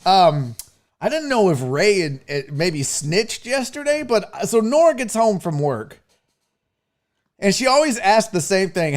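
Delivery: ordinary talk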